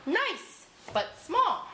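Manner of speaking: high little storybook fish; small fish voice. A narrator's reading. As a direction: pipe up high as a tiny fish. Small fish answers the whale.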